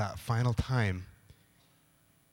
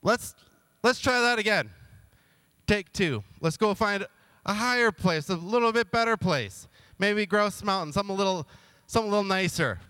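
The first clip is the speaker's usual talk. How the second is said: eager take two; 6 semitones higher than usual. Retold or imitated. King pushes for another try.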